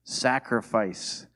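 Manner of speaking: "Sacrifice" drawn out slowly and weightily; slow emphatic. Real, not acted. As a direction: let "Sacrifice" sink in, slowly. Repeating word for emphasis.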